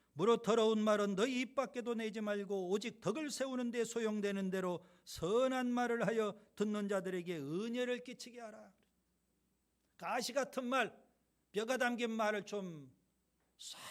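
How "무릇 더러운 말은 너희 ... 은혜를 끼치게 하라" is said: louder, measured Bible reading; scripture reading. Acted. Pastor reading scripture aloud to the church, loud and formal. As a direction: proclaim the verse to the congregation.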